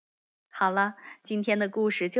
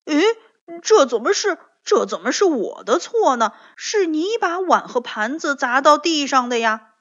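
loud, high-pitched protest; indignant retort; 5 semitones higher, 7 dB louder than usual. A narrator's reading. Tiaotiao hits back at Bengbeng's accusation, loud and indignant. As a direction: throw the blame straight back, loudly. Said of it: "跳跳立刻反驳说".

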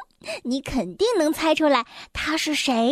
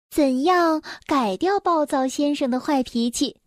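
plain reading voice

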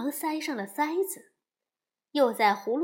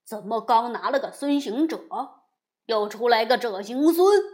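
loud, baffled demon king; startled loud question; surprised; 7 dB louder than usual. A narrator's reading. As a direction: blurt it out in loud disbelief. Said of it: "金角惊讶道".

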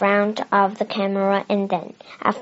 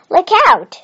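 much higher and louder alarmed shout; shouted warning; 10 semitones higher, 8 dB louder than usual. A narrator's reading. Mr Brown warning the family of the runaway camera, shouting. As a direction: yell it to get everyone out of the way. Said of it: "shouted Mister Brown".

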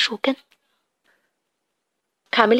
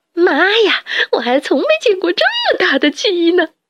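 much higher astonished exclaim; stunned surprise; amazed; 7 semitones higher than usual. Acted. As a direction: gasp at the giant chickens. Rat sees huge chickens.

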